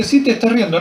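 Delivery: high-pitched, insistent retort in a retold argument; playfully insist, voicing the comeback; mock contradiction. God contradicts Sara's denial. (réplica insistente, imitada en broma)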